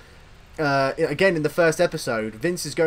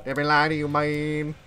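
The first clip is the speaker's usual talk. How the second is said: [name]'s impression; 2 semitones lower than usual. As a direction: imitate a bad Bray Wyatt impression. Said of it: "[name]'s really bad impression of Bray Wyatt".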